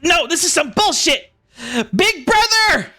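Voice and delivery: very high squeaky shout; squeaky bandit yelling. Acted. Smitty shrieks for his brother Toad, squeaky high. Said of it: "he yells out, he says".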